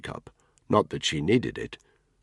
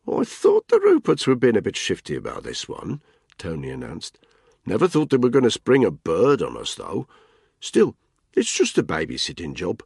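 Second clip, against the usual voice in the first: higher, louder bloke ribbing a mate; Tony's mocking voice; 5 semitones higher, 4 dB louder than usual. Tony teases Spud about his bike, mocking and raised. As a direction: needle him with a grin.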